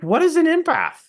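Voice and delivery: very high, incredulous question; baffled question. Retold or imitated. Speaker asks audience about unknown word, baffled. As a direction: act out bafflement, pitch shooting up.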